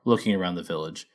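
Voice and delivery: ordinary delivery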